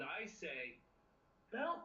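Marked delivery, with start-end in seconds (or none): 1.5-1.8 high pleading cry of help; fluttering cries for help. Shown as a demonstration. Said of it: "so I make that sound".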